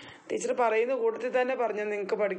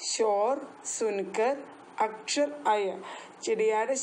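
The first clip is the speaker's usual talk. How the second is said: slow drill; slower than usual. Shown as a demonstration. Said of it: "എന്നാ പറഞ്ഞേ".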